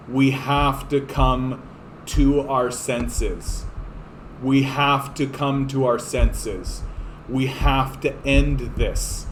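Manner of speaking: slow, deliberate pleading to Americans; slow earnest plea. Pretend. Canadian pleading slowly with Americans, slow and earnest. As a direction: spell it out slowly, begging them to listen. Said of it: "to say to our brothers and sisters".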